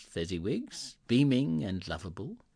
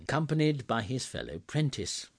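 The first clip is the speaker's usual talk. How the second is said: plain reading voice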